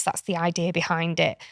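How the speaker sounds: ordinary talk, nothing marked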